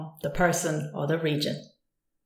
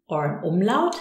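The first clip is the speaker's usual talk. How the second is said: ordinary talk, nothing marked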